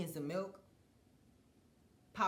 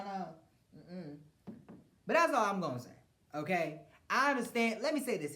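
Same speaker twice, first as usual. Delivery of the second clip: ordinary talk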